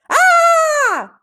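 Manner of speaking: high loud wail from below. A narrator's reading. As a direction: let out a sudden distorted wail. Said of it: "A sudden wail came up".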